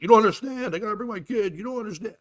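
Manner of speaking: high-pitched, agitated pleading. Retold or imitated. Driver pleading with passenger about his kid, upset. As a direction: imitate a driver getting worked up. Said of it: "then he starts getting upset".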